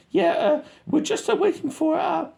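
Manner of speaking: squeaky radio voice. Retold or imitated. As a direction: mimic a soldier on the radio, squeaky. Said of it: "they're talking on the radio to Murph".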